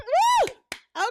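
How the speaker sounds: high, loud woo; excited cheer. Real, not acted. Host hyping her upcoming tour, cheering. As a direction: whoop with joy.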